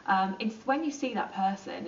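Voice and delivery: ordinary delivery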